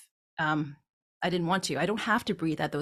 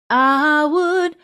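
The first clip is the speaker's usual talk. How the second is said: I, beat, would, louder and higher; pause between I and would; 8 semitones higher, 9 dB louder than usual. Shown as a demonstration. Teacher shows the pause in the phrase. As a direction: leave a beat after I. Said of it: "a little bit of a beat there".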